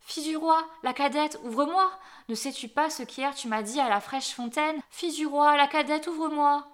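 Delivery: insistent high, loud call. A narrator's reading. Frog knocking a second time at the princess's door, calling out insistently. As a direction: call repeatedly through a closed door. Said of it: "la voix appela".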